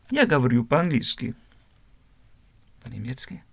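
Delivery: ordinary delivery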